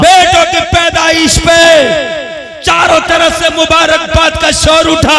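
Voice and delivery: loud, high, impassioned sermon delivery; fiery preaching. Acted. Preacher rousing the crowd about daughters, in a loud fiery sermon voice. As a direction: preach it hard to the congregation. Preaching continues at full force.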